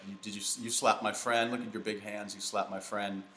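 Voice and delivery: ordinary talk, nothing marked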